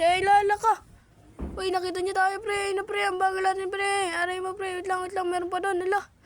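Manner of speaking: high, panicked yelping; frantic after being caught. Real, not acted.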